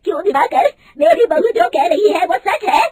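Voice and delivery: mother-in-law confronting the manager, loud and high; angry demand. A narrator's reading. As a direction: confront the cheat. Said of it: "गुस्से से दिवाकर को पूछती है".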